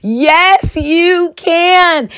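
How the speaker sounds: rally her like a room of supporters; family cheering on a weary mother in labour; encouraging chorus. Retold or imitated. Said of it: "unanimous voice".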